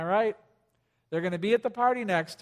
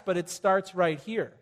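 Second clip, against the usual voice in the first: usual speaking voice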